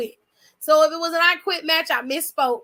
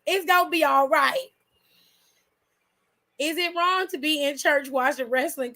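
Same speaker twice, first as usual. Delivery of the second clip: normal talking voice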